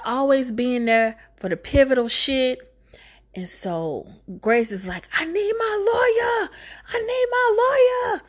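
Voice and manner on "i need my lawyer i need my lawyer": high, crying mock shout; mock panicked cry. Grace, cornered in her cell, cries for her lawyer in a high mock wail. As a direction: wail it like a hammed-up breakdown.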